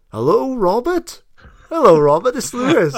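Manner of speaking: high-pitched mock phone hello; mimicked eager greeting. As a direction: imitate calling out hopefully down the line. Imitating co-host's call to guest.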